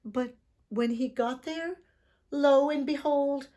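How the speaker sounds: usual speaking voice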